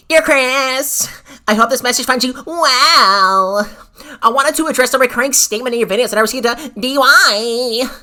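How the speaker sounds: hammed-up formal letter read; prim email voice. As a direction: read it like a pompous lawyer. Reading the email in put-on voice.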